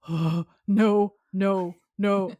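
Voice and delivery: loud, gasping comic panic; hammed-up horror, each word loud; mock flipping out. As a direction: act out a comic freak-out. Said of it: "flipping out, and it's funny".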